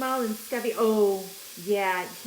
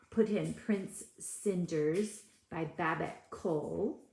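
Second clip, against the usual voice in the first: usual speaking voice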